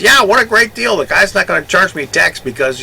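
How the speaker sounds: high, loud, put-on boast; loud gloating. Walter brags loudly about skipping the tax, high and gleeful. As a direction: brag so the whole store hears.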